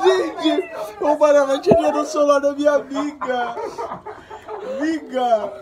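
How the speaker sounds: high, loud excited call. Real, not acted. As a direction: shout it out, thrilled.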